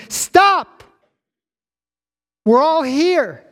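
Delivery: high, loud urgent yell; full-voiced shouted plea; Paul. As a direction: cry out to halt someone from afar. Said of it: "Paul yells to him".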